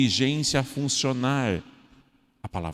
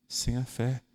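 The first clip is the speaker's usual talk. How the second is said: usual speaking voice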